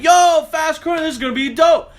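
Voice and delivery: high, loud, stoked yell; hyped shout. Retold or imitated. Leader shouting with glee before diving at the current. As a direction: holler it like a thrill-seeker.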